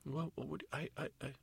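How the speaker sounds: high, soft, faltering character voice; flustered stammering. Acted. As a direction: stammer as if caught out. Godmother stammers under questioning.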